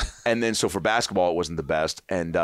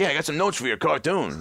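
cocky fan; 9 semitones higher than usual. Retold or imitated. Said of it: "I was like".

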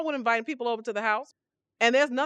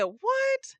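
much higher, startled yelp of disbelief; shocked what. Retold or imitated. She finds the restaurant closed, shocked. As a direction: pop up high in shock. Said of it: "And I said".

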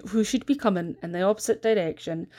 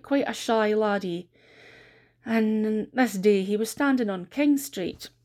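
ordinary delivery

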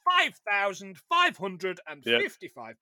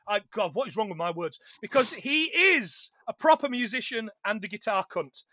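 ordinary talk, nothing marked

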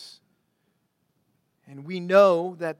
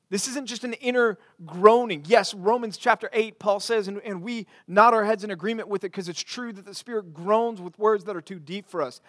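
usual speaking voice

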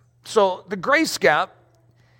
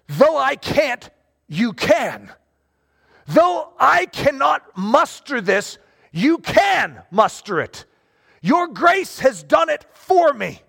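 slow, emphatic appeal to God; high on each admission, dropping firmly on the answer; fervent prayer; 5 semitones higher, slower than usual. Acted. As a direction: declare it to God, word by weighty word. Acted prayer of dependence on God.

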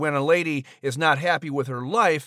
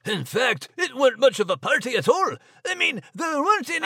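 higher, quick Scots brogue; Scottish mouse Max; 9 semitones higher than usual. Acted. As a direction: chatter away like a cheeky Scot. Max character interjects.

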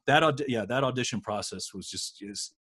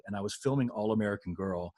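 ordinary talk

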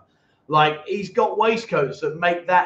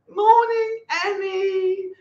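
much higher, louder, sing-song "Morning, Annie"; mock sweet greeting; 12 semitones higher, 3 dB louder, much slower than usual. Host mocking a viewer who waits for Annie, high mock-sweet greeting. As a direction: pitch it way up like a lovestruck fan.

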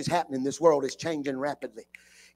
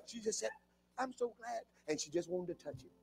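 much softer, tender hush; hushed preaching; 3 semitones higher, 15 dB quieter than usual. Real, not acted. Preacher to congregation, suddenly quiet and tender. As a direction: drop it to almost nothing after the shout.

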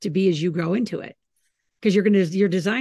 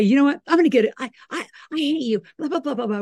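higher, louder mock teen outburst; mock angry teen; 7 semitones higher, 3 dB louder, faster than usual. Mother imitating an angry teen yelling at a parent. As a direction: play a kid storming off in a huff. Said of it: "When they say".

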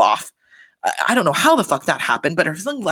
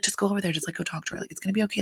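softer, whispered coaxing; hushed urging; 8 dB quieter, much faster than usual. Retold or imitated. Cast urging Jen to approach Meredith, whispered. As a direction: egg her on under your breath.

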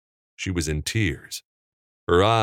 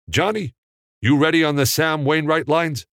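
higher, louder call across the set; director calling; 10 semitones higher, 4 dB louder than usual. A narrator's reading. Capra checks that Johnny is ready, raised voice. Director calls to off-camera actor.

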